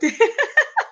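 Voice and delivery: short, high, rising giggle; high laugh. Real, not acted. Host giggling after thanking a viewer, high laugh. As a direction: let a bright giggle escape.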